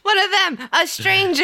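high, playful cry of alarm; mock horror. Co-host joins the body-snatcher bit, mock horror.

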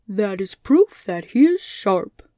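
a big lion rumbling out a joke. Acted. Lion joking about pins in Scarecrow's head, loud and slow.

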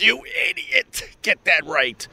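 very high, squeaky outburst; hammed-up high-pitched scolding; mock self-scolding. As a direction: jump way up high to berate yourself. Mock scolding himself for a slip.

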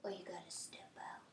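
breathy menacing whisper. Acted. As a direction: whisper it like a threat. Whispered threat.

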